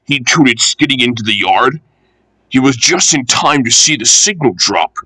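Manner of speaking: ordinary delivery, nothing marked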